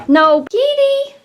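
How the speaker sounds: high-pitched, slow No Kitty; high slow plea. Real, not acted. Owner stops her wobbly cat from running into the wall, high slow plea. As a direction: plead with the cat, up high and drawn out.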